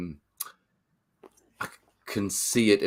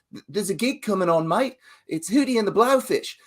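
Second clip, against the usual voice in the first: put-on high, bright Aussie soap-opera accent, pitched up; Australian accent; 9 semitones higher than usual. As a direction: do a Neighbours character in an Australian accent. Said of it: "It's like my Australian accent there".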